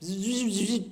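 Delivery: soft imitated cicada buzz; quiet bug drone; a gentle zzz like a cicada; buzzing noise.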